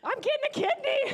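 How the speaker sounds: high, loud thrilled announcement; excited announcement. Retold or imitated. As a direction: imitate her shouting the good news. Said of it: "She announces to the people around her".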